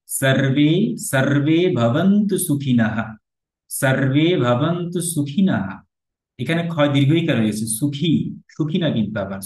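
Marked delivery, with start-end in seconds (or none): 0.1-5.5 সার্বে with a clear s and open a, slow; correct Sanskrit pronunciation. Shown as a demonstration. Teacher demonstrating the correct mantra, slow and clear. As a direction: chant সার্বে ভবন্তু সুখিনঃ carefully. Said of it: "তাহলে বলতে হবে সার্বে".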